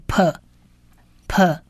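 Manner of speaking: p said as an isolated P syllable, then repeated; dotted P sound. Shown as a demonstration. Teacher showing the dotted letter's sound, p as P. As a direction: sound p as a puffed P, given twice. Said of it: "它其实是有黑点的".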